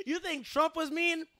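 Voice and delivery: mock fury breaking into giggles; laughing mock tirade. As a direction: rant like a tyrant while cracking up. Comic threat rant, cracking up.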